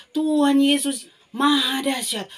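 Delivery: fervent praise. Real, not acted. Praising climax.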